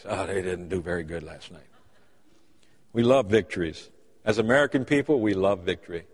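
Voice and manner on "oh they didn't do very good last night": low, soft, dejected report; glum scowl. Retold or imitated. Pastor reporting Kentucky's loss, low and glum. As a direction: grumble the loss quietly, downcast. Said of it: "puts that scowl on his face".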